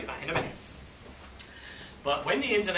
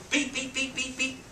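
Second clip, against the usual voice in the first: high, chirpy imitated beeping; pager beeps; 10 semitones higher than usual. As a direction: mimic a pager going off, high and bright. Pager going off again.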